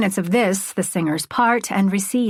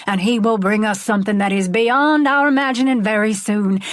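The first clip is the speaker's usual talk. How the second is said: revival preaching; 3 semitones higher than usual. Acted. Preacher addresses stadium.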